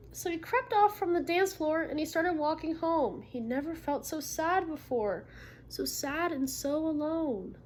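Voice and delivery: picture-book read-aloud; reading to children. Acted. Teacher reading a story to the class.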